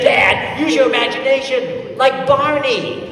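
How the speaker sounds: put-on high, indignant child scolding Dad; mad little boy. Four-year-old son telling off his dad, mad. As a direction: imitate a small kid's loud exasperation, drawn out at the end. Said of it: "And then he got mad".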